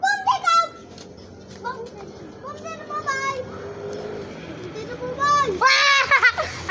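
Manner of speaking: giggling fit; long laughter. Real, not acted. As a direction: laugh helplessly for a while. (tawa nang tawa)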